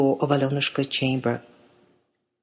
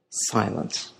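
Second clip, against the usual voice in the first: ordinary delivery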